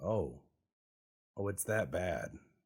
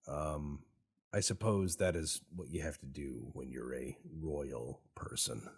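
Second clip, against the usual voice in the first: normal talking voice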